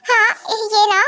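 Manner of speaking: normal talking voice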